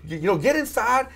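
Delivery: mock-order him back inside; imitating his own pushy friend; retold scolding. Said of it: "I'm like".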